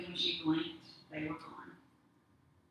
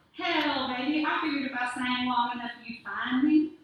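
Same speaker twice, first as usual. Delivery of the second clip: loud cowboy greeting; 6 semitones higher, 8 dB louder than usual. Acted. Ghost cowboy greets her.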